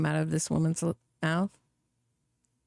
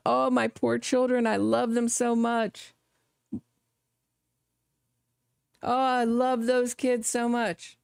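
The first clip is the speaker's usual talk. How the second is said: lightly higher pretend lament; a hint of a hammed-up mourning mother; mock grieving mother; 6 semitones higher than usual. Host voicing what a grieving mother would cry, mock lament. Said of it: "Notice she wasn't crying out".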